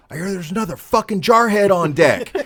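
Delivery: big, high shout across the office; loud, rowdy acted-out holler; hollered Marine greeting. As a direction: bellow it out to hail a fellow Marine.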